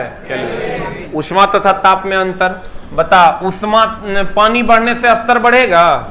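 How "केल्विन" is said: low, slow, soft mumble of a shy student; soft drawn-out "kelvin" as if a student is guessing; mumbled answer. Pretend. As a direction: mumble the answer under your breath like an unsure pupil. Teacher mimics a mumbled student answer.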